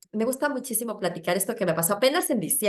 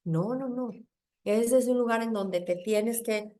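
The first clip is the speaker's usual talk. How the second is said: usual speaking voice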